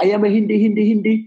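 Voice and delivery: mock Indian English; Indian accent. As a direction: imitate an Indian accent, tongue stuck up high. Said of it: "por el hindi".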